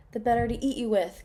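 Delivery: spring the trap on her; a mild mock cry of the wolf; wolf cries out. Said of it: "cried the wolf".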